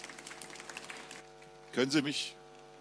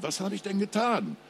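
panisch; 9 semitones higher, 5 dB louder than usual. Acted. Rocco panicking on phone.

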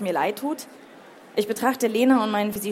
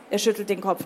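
usual speaking voice

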